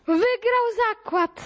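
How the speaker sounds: high, slow celebratory call; triumphant announcement. Acted. Announcing Fogg's victory in the school play, triumphantly. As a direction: proclaim the win in a high, drawn-out voice.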